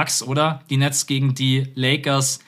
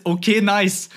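light, mock casual reply; quoting Björn; 7 semitones higher, slower than usual. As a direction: toss it off as Björn. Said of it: "Björn antwortet". (leicht nachgeahmte lässige Antwort)

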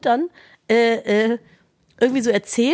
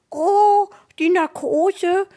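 higher, slow, cooing; baby talk to a dog; 6 semitones higher, much slower than usual. Retold or imitated. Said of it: "mit einer verstellten Stimme".

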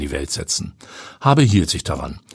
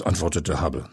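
ordinary delivery

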